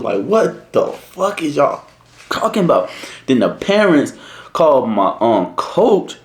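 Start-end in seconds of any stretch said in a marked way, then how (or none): 0.2-2.9 high, slow incredulous question; pitched-up, drawn-out protest; retold bafflement. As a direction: drag it out in mock disbelief. Teller retells bewildered reply to accusation.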